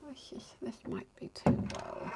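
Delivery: breathy whisper under her breath; hushed, muttering to herself. Real, not acted. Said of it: "whispering while hanging portraits".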